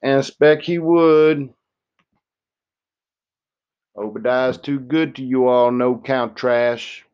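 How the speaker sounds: a mildly voiced old woman teasing a visitor. A narrator's reading. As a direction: speak up high and sharp as a tiny tough old woman. Old woman scolds him.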